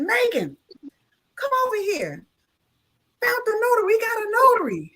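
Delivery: high-pitched excited summons; calling someone over. Retold or imitated. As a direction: imitate someone eagerly calling a colleague across. Retells man calling colleague over.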